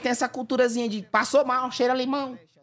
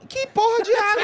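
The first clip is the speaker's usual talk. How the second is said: incredulous outburst; 13 semitones higher, 3 dB louder than usual. Real, not acted. Indignant outburst.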